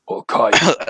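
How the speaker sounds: low, menacing Hakai; deep anime villain voice. Retold or imitated. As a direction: growl it like a god of destruction. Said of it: "you gotta lower your voice".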